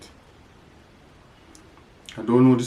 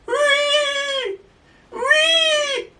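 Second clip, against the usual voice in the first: pig squeal. Shown as a demonstration. Pig sound for toddler.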